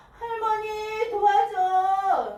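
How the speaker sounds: high, long-held shout for help. A narrator's reading. Mother and her mother shout to Grandma, calling out. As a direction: stretch the call out loud and high. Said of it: "함께 외쳤어요".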